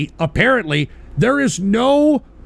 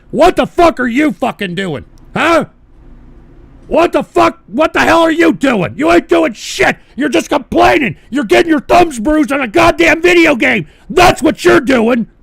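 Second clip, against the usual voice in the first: higher and louder, yelling at the gamers; furious shout; 7 semitones higher, 6 dB louder than usual. Acted. Angry streamer yelling at chat viewers, furious shouting. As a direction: blow up at them, full rage.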